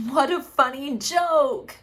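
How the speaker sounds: high, loud mock cheer; sarcastic. Real, not acted. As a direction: drip with sarcasm. Sarcastic comment on cruel joke.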